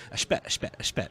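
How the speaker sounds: quiet Italian American "A spet, a spet"; Italian American slang. Shown as a demonstration. Showing how his coworker says hold on, in Italian American style. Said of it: "He goes".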